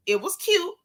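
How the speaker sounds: "cute" said up high and sweet; high cutesy "cute". Shown as a demonstration. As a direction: coo the word "cute".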